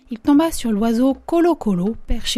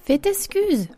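high-pitched, courteous little apology; small, well-mannered interruption; very polite; 7 semitones higher, slower than usual. A narrator's reading. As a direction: pipe up politely, higher than usual. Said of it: "avec la plus grande politesse".

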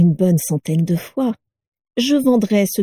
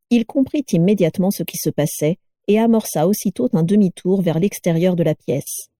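usual speaking voice

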